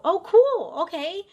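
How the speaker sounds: sudden high, loud delighted pop; light up at the news; bright surprise. Retold or imitated. Bartender pleased the name fits, high surprised chirp.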